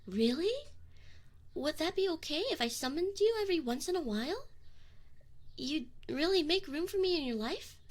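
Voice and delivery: can't believe his luck; hopeful surprise. Acted. Demon surprised and eager as summoner agrees.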